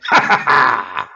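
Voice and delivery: loud villainous cackle played back; evil cackle. Shown as a demonstration. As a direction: mad villain laugh.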